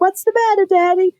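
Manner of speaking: put-on little boy. Little sons asking their crying dad, childlike. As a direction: sound like an eight-year-old.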